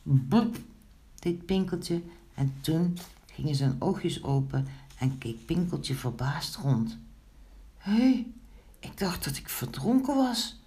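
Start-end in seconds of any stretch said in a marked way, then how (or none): 0.0-0.6 single popping blub; bubbling noise. A narrator's reading. Imitates Pinkeltje spitting water. 7.8-10.6 high-pitched surprise; astonished. A narrator's reading. Figure comes round astonished.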